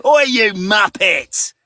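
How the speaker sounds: loud, slow, high mock shout; blunt British insult. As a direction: bark it like a cheeky Brit. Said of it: "it's just so comical".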